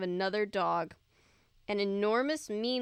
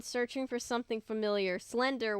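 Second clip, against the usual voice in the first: ordinary delivery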